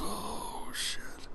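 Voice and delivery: hushed, breathy "Oh, shit"; whispered aside. Real, not acted. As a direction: say it under your breath, overwhelmed. Reader's aside to himself.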